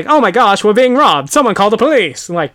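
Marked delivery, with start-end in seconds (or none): 0.1-0.6 loud, high mock alarm; old-movie bank teller. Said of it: "the bank teller doesn't say". 0.6-2.3 loud, raised mock-alarm voice; old-movie bank teller. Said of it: "the bank teller doesn't say".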